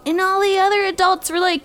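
ordinary delivery, nothing marked

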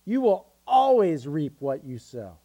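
always underlined with weight and a fall; stress on always. Shown as a demonstration. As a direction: lean hard on always. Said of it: "underline always in your Bible".

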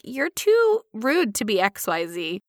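slow, deliberate comeback with TOO drawn out; measured, snarky echo; slow retort. Shown as a demonstration. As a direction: echo it back slowly, leaning on TOO rude. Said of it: "I'm like".

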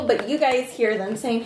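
ordinary delivery

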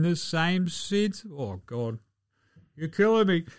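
a comic hint of heartbreak going soft; mock heartbroken, trailing quiet. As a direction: voice dropping away, mock choked up. Mock-emotional reading of the letter.

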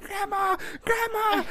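imitating a panicked little girl; panicked calling. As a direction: shriek for grandma like a terrified little girl. Scared niece calling for help.

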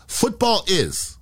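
slow, drawn-out "Football is"; measured mock ad slogan; imitating commercial caption. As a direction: hold each word like a slogan left on screen. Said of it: "the commercial did this".

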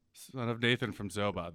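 ordinary talk, nothing marked

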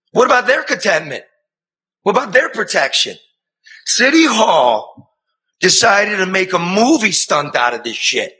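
indignant rant. Real, not acted. A reporter ranting at City Hall over the widow.

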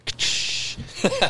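unvoiced sound effect of shattering glass; imitated glass smash. Boy on the roof drops glass, imitated smash. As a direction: mimic glass crashing down. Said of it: "we're dropping pieces of glass".

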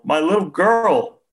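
the father's grief breaking fully, slow high wail; wailing sob. A narrator's reading. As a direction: let the grief peak in a long wail. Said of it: "he choked out".

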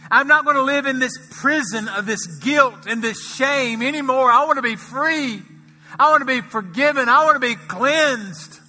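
high, loud impassioned preaching; preacher voicing a sinner's cry for freedom; preaching climax. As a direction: cry out the plea full force.

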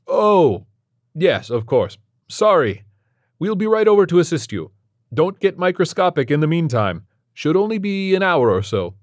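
high, loud cheery reassurance; breezy, upbeat doctor voice; chirpy doctor. A narrator's reading. Doctor cheerily promises Stephen help is on its way. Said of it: "chirpy and grating voice".

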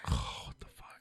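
whispered cursing to oneself; frustrated whisper. Real, not acted. Host struggles to recall an actor's name, frustrated whisper.

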